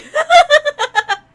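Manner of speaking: loud burst of laughter; a high, loud, real laugh; big delighted laugh. Real, not acted. Streamer delighted by cutscene.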